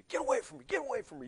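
shrill panicked mock yell at the attacker; hammed-up frantic cry to back off; retold yelling. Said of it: "He's yelling at me".

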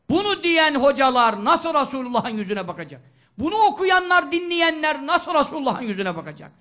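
loud, high preaching outcry; impassioned shout. Acted. Preacher rebukes those who listen to deniers, shouting. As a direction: cry it out in rebuke.

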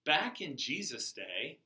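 usual speaking voice

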